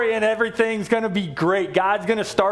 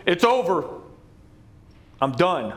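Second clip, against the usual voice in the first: final divine voice; 4 semitones lower than usual. Acted. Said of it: "this voice goes".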